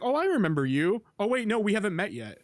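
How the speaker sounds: high, quick, lightly acted voice of the character; character's voice. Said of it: "He's like".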